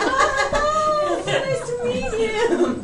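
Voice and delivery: very high, drawn-out singsong hello; mock gushing greeting. Daughter re-enacting meeting all the staff, gushing mockingly. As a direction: squeal a slow, over-sweet greeting.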